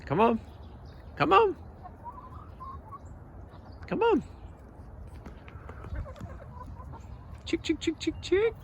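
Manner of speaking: high sing-song call to hens; calling chickens. Real, not acted. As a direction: coax the hens out, high and bright.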